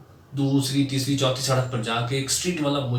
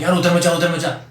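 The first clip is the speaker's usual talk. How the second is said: louder, higher, rushed urgency; urgent excited friend; 6 semitones higher, 7 dB louder, faster than usual. Retold or imitated. Friend running up to warn him, urgent louder call. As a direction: hurry him off with a yell. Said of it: "कहता है".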